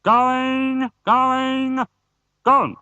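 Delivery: high, drawn-out auction call; auctioneer calling. Acted. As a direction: bring the hammer down. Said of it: "the auctioneer cried".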